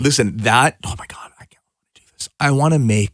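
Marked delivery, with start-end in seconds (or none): 0.8-2.3 breathy whispered aside. Real, not acted. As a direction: whisper it to yourself.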